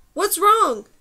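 high, loud shouted question; shouting. A narrator's reading. Man calling to the girl over the wind, shouting. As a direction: shout it over a strong breeze. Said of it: "Numer was forced to shout".

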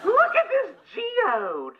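high and delighted; excited. Real, not acted. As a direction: show it off with glee. Excited showing geode.

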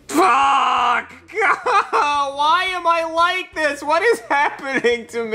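high sobbing laugh; crying-laughing breakdown. Acted. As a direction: fall apart in tears and laughter. Misspoke, breaking down.

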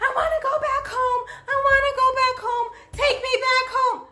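imitating a child crying to go home; mimicked crying child. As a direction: whine it like a sobbing little kid.

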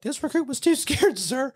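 high, meek recruit impression; scared recruit. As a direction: imitate a frightened recruit owning up. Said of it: "And he's like".

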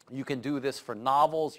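normal talking voice

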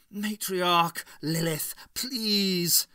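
high, forceful final please; urgent appeal. A narrator's reading. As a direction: call to her urgently, begging. Doctor begs the villain to stop.

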